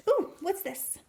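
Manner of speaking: plain reading voice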